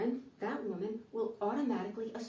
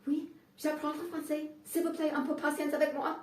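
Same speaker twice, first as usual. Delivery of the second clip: higher, halting learner's French; higher voice, pleading in learner's French; 6 semitones higher than usual. Retold or imitated. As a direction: beg for patience in shaky schoolbook French. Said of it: "And I'm like".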